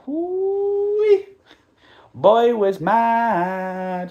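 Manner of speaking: high squealing whoo-wee, then slow loud drawl; whoo-wee exclamation. Real, not acted. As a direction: hoot it, then drag out the anger. Exclaiming about Zlatan's rage.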